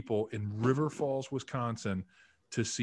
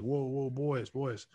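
lightly imitated North Carolina accent; a hint of a Southern line coach halting the group; Alex Gibbs's Southern accent; slower than usual. Line coach halts his players, light Southern accent. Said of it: "Southern North Carolina accent".

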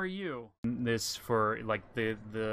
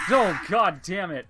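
panicking gamer; 4 semitones higher, 7 dB louder than usual. Real, not acted. Jump scare while playing horror game.

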